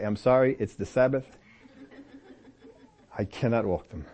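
low, soft, halting pious excuse; mock apology. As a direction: refuse solemnly, pausing between phrases. Mock excuse to mom and dad.

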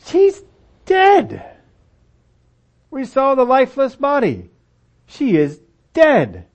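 high, loud retort; scoffing mourner. Acted. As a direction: bark it back in disbelief.

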